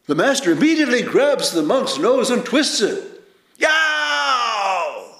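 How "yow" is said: sudden high yelp of pain. Retold or imitated. Monk crying out as the master twists his nose, sharp yelp. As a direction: yelp like your nose just got twisted.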